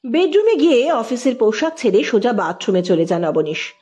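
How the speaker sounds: plain reading voice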